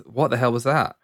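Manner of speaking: ordinary talk, nothing marked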